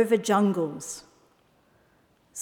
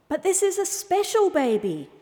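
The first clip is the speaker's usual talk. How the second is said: high-pitched donkey voice; explaining donkey; 9 semitones higher than usual. A narrator's reading. Donkey explaining to the owl, high-pitched. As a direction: plead the case in a high voice. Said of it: "explained the donkey".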